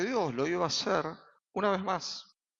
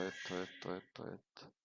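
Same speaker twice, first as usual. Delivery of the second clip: breathy fade-out of the repetition; whispered tail of a sermon list; fading to a whisper; 8 semitones lower, 14 dB quieter than usual. Acted. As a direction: let the words die away into breath.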